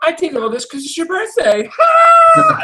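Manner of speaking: hammed-up cackling shout; mock villain shout. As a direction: yell it like a gleeful mad villain. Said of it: "she's like".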